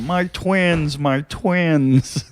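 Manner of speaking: a hint of the sitcom dad whine; Ray's twin jokes. Comic mocking a fellow comic's jokes, light impression. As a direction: lightly mimic the comedian's bit. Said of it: "He does funny jokes about".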